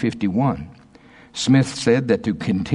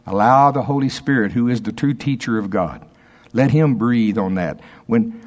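ordinary talk

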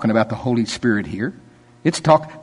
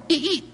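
high squeal of braking tires; imitated tire screech; 13 semitones higher, 6 dB quieter than usual. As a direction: mimic the car screeching to a stop. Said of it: "My car went".

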